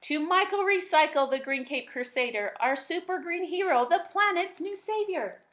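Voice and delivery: mildly hammed-up cheering crowd; excited townsfolk cheer. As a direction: raise a glass to the hero. Townsfolk toast their hero.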